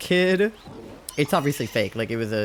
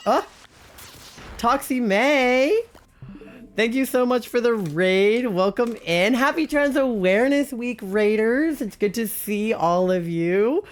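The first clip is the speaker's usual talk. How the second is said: high, bright burst of welcome; excited greeting; 8 semitones higher than usual. Real, not acted. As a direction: cheer the raiders in. Streamer greeting incoming raiders.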